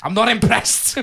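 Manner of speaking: high, loud, prim examiner voice; driving examiner impression. [name] as driving examiner scolding Cal, high prim voice. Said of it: "doing a driving lesson".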